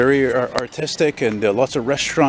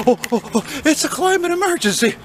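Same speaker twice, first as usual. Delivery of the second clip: much higher, squeaky panicked parody; mock hysterical activist. Vlogger parodies climate panic in a high squeaky voice. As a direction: shriek it like a frantic doomsayer.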